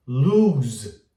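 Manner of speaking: drawn-out oo in "lose"; long vowel. Shown as a demonstration. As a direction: hold the tense oo of "lose". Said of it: "ele pode ser longo". ("lose" com U longo)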